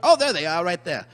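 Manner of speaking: ordinary delivery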